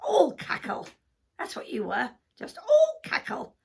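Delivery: loud, high scolding sheep; sheep character. Acted. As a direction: accuse the hen as a bleating sheep. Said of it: "Sheep came along".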